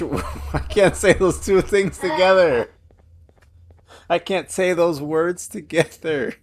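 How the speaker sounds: high, loud, slow words through laughter; real helpless laughter, voice pushed up high; laughing helplessly. Real, not acted. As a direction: crack up while trying to talk. Cracking up failing tongue twister.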